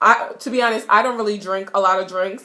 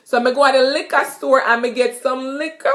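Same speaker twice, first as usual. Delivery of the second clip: fast Jamaican patois, higher and louder; 4 semitones higher, 3 dB louder than usual. Shown as a demonstration. Teacher giving a patois liquor store example. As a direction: rattle it off Jamaican style. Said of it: "So liquor is a liquor".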